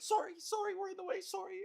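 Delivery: very high, mock-polite apology; apologetic Canadians. Two shoppers turning to someone they block, mock apologetic. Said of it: "they turn around and they're like".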